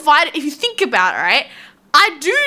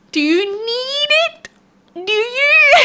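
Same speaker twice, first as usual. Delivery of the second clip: high, drawn-out Valley Girl accent, mocking; mock Valley Girl accent, stretching need slowly; 10 semitones higher, slower than usual. Mocking vitamin lovers in a Valley Girl accent. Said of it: "Valley Girl accent".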